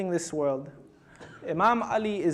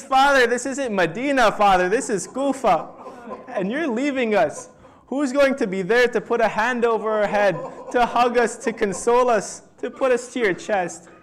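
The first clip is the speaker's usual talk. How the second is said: raised, louder lament to a dying father; impassioned grieving plea; 3 semitones higher, 5 dB louder than usual. Retold or imitated. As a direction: cry out the son's plea with mounting anguish. Son laments his dying father leaving.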